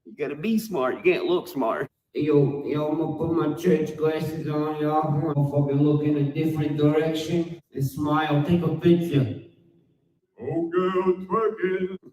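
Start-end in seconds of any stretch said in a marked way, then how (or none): 2.1-9.4 louder hammed-up poser; mock poser voice. Rapper mocking Adam posing in glasses, loud hammed-up poser. 10.4-12.0 higher, louder silly voice; mock rival. Rapper mocking rival dancing, silly mock voice.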